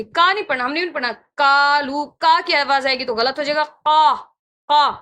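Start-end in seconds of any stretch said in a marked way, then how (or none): 3.6-4.9 قا with a full throaty ق; heavy qaaf drill. Shown as a demonstration. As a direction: drill قا with a thick throat sound. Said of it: "اس طرح کی".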